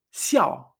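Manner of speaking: fourth-tone fall on "xiao". Shown as a demonstration. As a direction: send "xiao" firmly downward.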